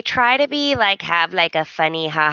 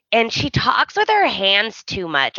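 ordinary talk, nothing marked

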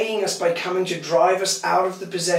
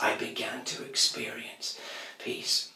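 softly whispered, much softer; hushed; 5 semitones lower, 10 dB quieter than usual. A narrator's reading. Pastor telling the church of finding peace, hushed. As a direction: breathe the word peace out.

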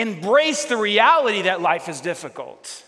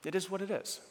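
soft reflective echo; 11 dB quieter than usual. Shown as a demonstration. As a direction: say it softly to yourself. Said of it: "preacher repeats friend's words".

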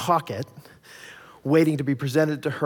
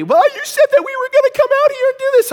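much higher, louder mock wife voice; whiny wife; 8 dB louder, faster than usual. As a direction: ham up the wife's complaint. Wife complaining in the store.

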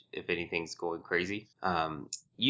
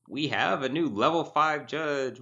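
slow, higher-pitched big announcement; announcer build-up; 6 semitones higher, slower than usual. Pretend. Podcast host unveils the only news item, announcer build-up. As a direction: draw out the big reveal with rising pitch.

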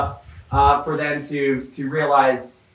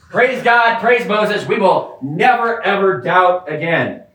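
mock praise; 4 semitones higher, slower than usual. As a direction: exaggerate the crowd's empty promise. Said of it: "They're like".

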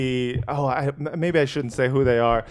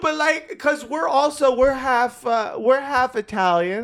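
high-pitched, hurried self-justifying voice; insistent duo; 9 semitones higher than usual. Retold or imitated. Comedy duo excusing their slur after the show, high insistent voice. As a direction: plead it high, explaining it away. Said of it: "They're like".

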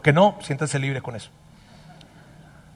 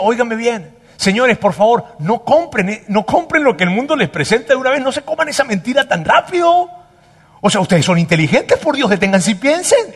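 Paul pleading, higher and louder; urgent appeal; 6 semitones higher, 4 dB louder than usual. Acted. Paul urging the congregation not to buy the lie, higher and louder. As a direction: press the crowd to stop and think. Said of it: "Pablo nos está diciendo".

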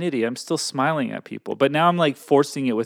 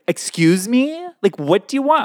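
high, loud snippy "Excuse me?"; sassy clerk talking back, pitch up; attitude; 10 semitones higher, 6 dB louder than usual. Retold or imitated. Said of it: "giving me attitude".

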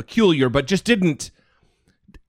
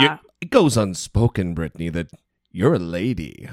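deep, low seductive croon; sexy guy voice; 10 semitones lower than usual. Retold or imitated. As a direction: lay on the smooth lower voice.

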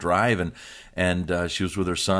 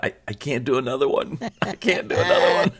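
laughing inner voice; 6 semitones higher than usual. Retold or imitated. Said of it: "and I thought".

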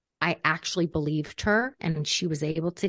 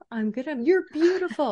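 over-eager reassuring parent; 9 semitones higher than usual. Retold or imitated. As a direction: protest far too hard, pitched way up. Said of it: "we're so inclined to be like".

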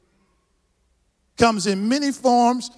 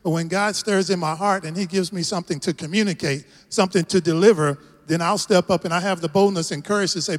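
usual speaking voice

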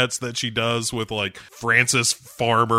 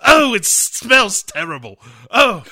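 higher, louder mock disgust; disgusted groan; 7 semitones higher, 6 dB louder than usual. Bond reacting to the smell, hammed-up disgust. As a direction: recoil from the stench, hammed-up.

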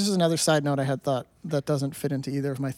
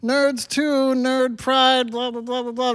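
high-pitched, drawn-out parody of proud nerds; mimicking self-proclaimed nerds, slow and high; mock nerd pride; 6 semitones higher, slower than usual. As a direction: imitate geeks gushing proudly, pitched up and stretched out. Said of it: "they take pride in being like".

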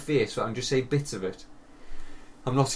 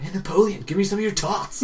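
higher, put-on heckler voice; Carl; 7 semitones higher than usual. Imitating his friend joking through a horror film, put-on heckle. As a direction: imitate a mate heckling the screen. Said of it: "Carl's sitting there just like".

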